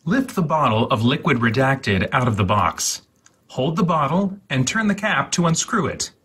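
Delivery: ordinary talk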